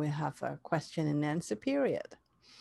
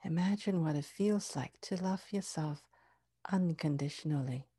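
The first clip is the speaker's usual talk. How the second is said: guided meditation; 3 dB quieter than usual. Acted.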